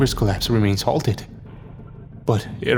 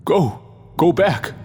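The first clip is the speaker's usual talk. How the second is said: higher-pitched urgent narrated shout; 7 semitones higher than usual. A narrator's reading. Struggling man sends the others away, shouting. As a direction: order them back with a raised voice. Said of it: "he shouts".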